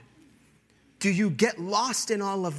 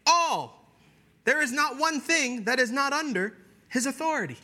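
raised, emphatic preaching voice; 8 semitones higher, 3 dB louder than usual. Acted. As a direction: preach it high and strong. Preaching at high pitch.

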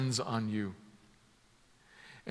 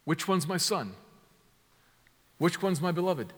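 a mildly raised, questioning "Which one's"; posed question; 4 semitones higher than usual. Acted. Preacher voicing the question the Father answers, mildly raised. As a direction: ask it like someone searching the crowd. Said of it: "As if answering the question".

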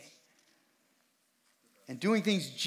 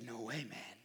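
quiet firm refusal; 5 semitones lower, 9 dB quieter than usual. Retold or imitated. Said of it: "And Jesus said".

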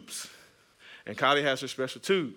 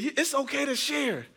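put-on high, parent-to-child coaxing; coaxing dad; 12 semitones higher than usual. Dad telling daughter about sharing, high coaxing voice. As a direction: lift the pitch to placate a small child.

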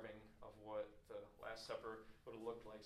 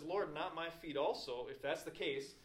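loud, higher, eager outburst as Peter; sudden acted eagerness; excited; 4 semitones higher, 6 dB louder than usual. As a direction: plead it with excited force. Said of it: "super excited".

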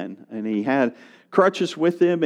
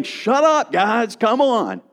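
high-pitched, exasperated shushing; rebuking crowd; 6 semitones higher than usual. Retold or imitated. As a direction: scold them, stretching the last words. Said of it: "the crowd rebuked them".

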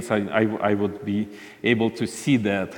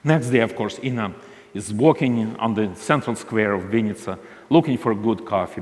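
ordinary delivery

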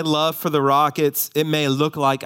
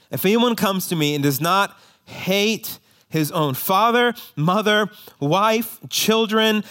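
higher, ringing scripture reading; scripture read with force; 7 semitones higher, 4 dB louder than usual. Acted. Pastor reading Jesus's hard saying to the congregation, raised and solemn. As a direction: proclaim the verse with weight.

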